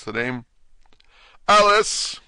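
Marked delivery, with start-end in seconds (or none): 1.5-2.2 high, loud rabbit squeal; piercing little herald's call; shrill little voice. A narrator's reading. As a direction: shout it at the top of a shrill voice. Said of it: "at the top of his shrill little voice".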